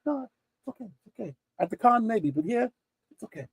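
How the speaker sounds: soft, easygoing reassurance; hushed, kindly refusal; imitated gentle reassurance. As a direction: wave it away quietly, playing a kind man. Acts Alan gently waving money away.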